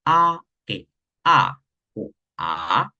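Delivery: a sung through the four tones, slow; four Mandarin tones on a. Shown as a demonstration. Teacher demoing the four tones to the class. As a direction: glide a through level, rising, dipping, falling. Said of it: "No es lo mismo decir".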